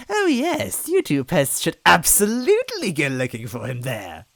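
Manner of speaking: high, cackling witch voice; laughing. A narrator's reading. Villain sending pests away, laughing witch voice. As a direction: laugh through it cruelly. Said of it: "she laughed".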